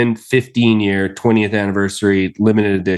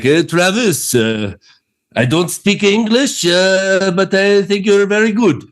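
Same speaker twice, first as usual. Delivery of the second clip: higher-pitched mock French accent; 8 semitones higher than usual. Whiskey fan praising a visiting presenter, in a French accent. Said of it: "they're gonna love my French accent".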